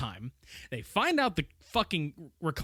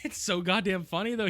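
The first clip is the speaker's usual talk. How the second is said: ordinary talk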